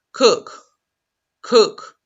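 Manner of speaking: "cook" said clearly for learners to repeat; teacher drills "cook"; model word. Shown as a demonstration. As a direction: give "cook" as a clean model. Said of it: "Repeat after me".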